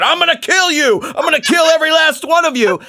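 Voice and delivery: high, shouted mock threat of a knife attacker. Imitating a knife attacker shouting threats at his victims. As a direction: yell it like a crazed attacker, hammed up. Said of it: "with a knife and going".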